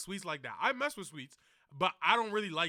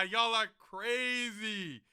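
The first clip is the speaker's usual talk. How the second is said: ordinary delivery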